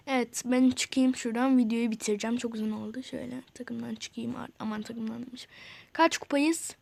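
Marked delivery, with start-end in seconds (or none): 2.4-5.5 whispered to yourself. Real, not acted. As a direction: breathe it, no voice. Muttering while navigating menu.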